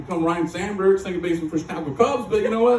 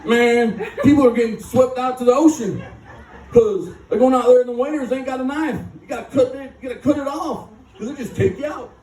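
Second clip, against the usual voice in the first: exaggerated Kentucky accent, pitched up; mock Kentucky drawl; 6 semitones higher than usual. Laughing speaker mocking a Kentucky guy at a meeting, Kentucky accent. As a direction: lay on a hammed-up Kentucky accent. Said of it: "no offense to Kentucky".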